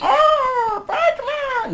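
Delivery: a high, whiny cartoon Bane crying out. Shown as a demonstration. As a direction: whine it like a sulky villain. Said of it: "Does he do it like that, like a whiny?".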